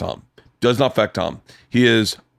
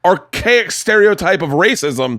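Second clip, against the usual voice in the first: loud, higher rant that climbs to a shouted fuck you; angry rant; 6 semitones higher, 3 dB louder, slower than usual. Real, not acted. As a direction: tear into him, building to fuck you. Ranting at offended old host.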